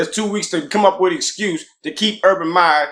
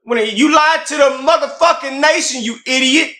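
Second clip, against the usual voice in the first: high, heated rant; heated outburst; 9 semitones higher than usual. Real, not acted. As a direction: call him out with disbelief. Heated argument over Urban Meyer.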